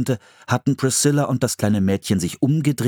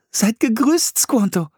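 put-on light, high girl's voice; girl's greeting; 3 dB louder than usual. Anne greets Squanto in a high girl's voice. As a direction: greet him brightly as young Anne. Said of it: "sagte sie".

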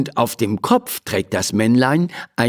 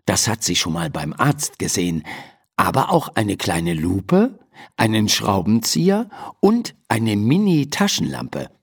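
ordinary delivery

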